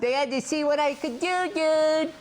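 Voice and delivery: high-pitched imitation of friend's excuse; mock pleading excuse.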